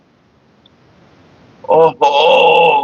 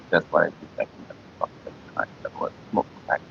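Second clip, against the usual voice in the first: breathy whisper, much softer; whispered aside; 10 semitones lower, 13 dB quieter, faster than usual. Real, not acted.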